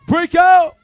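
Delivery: shouted command to the room; loud call. Real, not acted. Calling the group to split.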